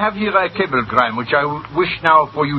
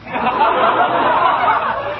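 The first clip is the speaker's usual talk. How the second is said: high laugh. Acted. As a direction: burst of high cackling laughter. Villain laughing at the joke.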